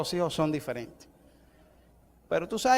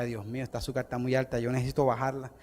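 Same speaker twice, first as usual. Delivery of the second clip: much softer, low worried plea; quiet retold prayer; 5 semitones lower, 8 dB quieter than usual. Sick preacher recalling his prayer, quiet plea. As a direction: murmur it like a private prayer.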